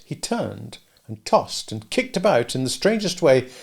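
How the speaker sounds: ordinary delivery, nothing marked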